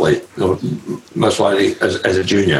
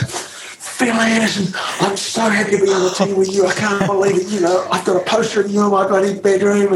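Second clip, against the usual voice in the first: put-on much higher, gushing starstruck kid voice; young Lance Armstrong; 13 semitones higher than usual. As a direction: gush like an overeager young fan meeting his hero. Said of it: "and he goes".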